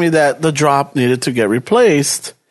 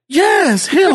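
imitating lady; 10 semitones higher than usual. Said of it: "She's all like".

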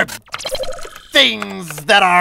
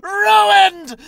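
high, slow anguished crying; despairing wail; 10 semitones higher, 3 dB louder, much slower than usual. Acted. Villain to Jones, wailing that all is ruined. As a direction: wail over the ruin.

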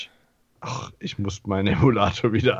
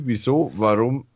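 usual speaking voice

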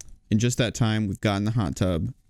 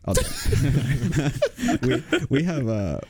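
volunteering through real laughter; laughing; 5 semitones higher, much slower than usual. Real, not acted. Resident agreeing to answer the host, laughing. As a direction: break up laughing while giving in.

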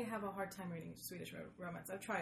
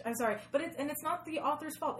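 normal talking voice

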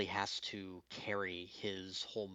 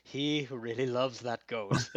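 put-on Viktor's accent; 2 semitones higher, 5 dB louder than usual. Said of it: "and he goes".

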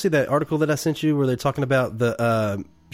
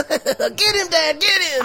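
put-on creepy giggly kid, very high-pitched; Boba; 3 dB louder, much slower than usual. Podcaster mimics young Boba during the chase, creepy high giggling voice. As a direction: squeal it through a creepy little laugh, imitating an excited boy. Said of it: "his little creepy laugh when they're".

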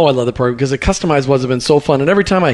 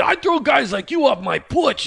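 high, slow, hammed-up Jersey accent; mock New Jersey porch threat; 7 semitones higher, 5 dB quieter, slower than usual. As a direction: imitate a Jersey tough guy, drawn out. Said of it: "a great story out in New Jersey".

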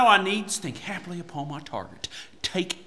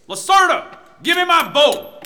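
loud, higher command; bossy summons; 7 semitones higher, 5 dB louder than usual. Acted. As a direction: bark for the bow. Knight summoning his servant.

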